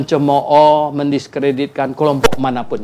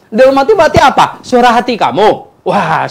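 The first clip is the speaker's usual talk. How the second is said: usual speaking voice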